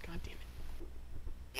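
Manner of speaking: slow whispered curse; whispering. Real, not acted. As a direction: hiss it under your breath. Frustrated aside while ignored.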